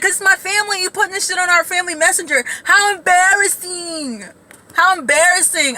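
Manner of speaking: high-pitched mock indignation; indignant cousin. Cousin objects to posts on the family messenger, indignant.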